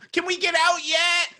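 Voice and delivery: put-on high, slow pleading kid voice; whiny child voice. Kid in timeout pleading to be let out, high and whiny. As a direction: beg like a child stuck in timeout. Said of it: "everybody who's like".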